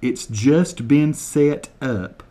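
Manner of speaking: slow, deliberate over-enunciation of each word; slow mock translation. Shown as a demonstration. Translating Kevin's Scottish for viewers, slow and deliberate. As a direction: spell it out slowly for Americans, deadpan. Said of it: "translate word for word".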